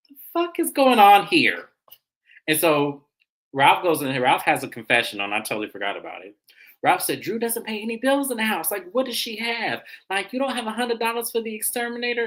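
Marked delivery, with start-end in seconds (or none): 0.1-1.7 loud, higher stunned outburst; shocked outburst. Retold or imitated. Watching the ex-husband's scene, a loud shocked outburst. Teller's retold shocked reaction. 7.2-12.3 higher, whiny mock of the ex-husband; mock Ralph complaining. The ex-husband griping about bills, higher mock whine.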